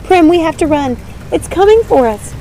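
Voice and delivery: loud, high urgent yell; panicked call to flee; urgent shout. Acted. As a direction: shout at her sister to run. Fleeing the vortex.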